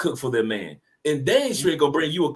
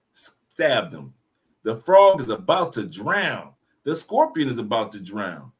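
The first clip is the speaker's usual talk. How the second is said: usual speaking voice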